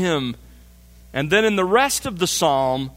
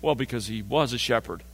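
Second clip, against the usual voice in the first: mock easy answer; 7 semitones lower, 7 dB quieter, faster than usual. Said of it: "tempted to go with the easy answer".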